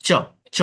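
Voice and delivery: চ repeated alone, crisp; isolated sound demo. Shown as a demonstration. Teacher repeating the চ sound for the class. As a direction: say চ twice on its own. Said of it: "উচ্চারণটি চ".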